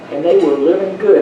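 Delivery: ordinary talk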